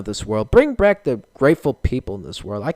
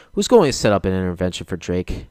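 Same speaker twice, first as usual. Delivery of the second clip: ordinary talk, nothing marked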